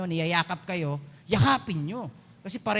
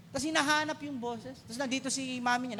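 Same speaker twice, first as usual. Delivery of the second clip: usual speaking voice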